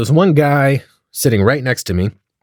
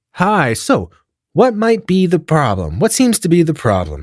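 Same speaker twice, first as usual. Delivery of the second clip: lightly voiced nurse, pitched higher; higher-pitched, questioning nurse voice; 6 semitones higher than usual. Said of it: "then the nurse sits next to her on this".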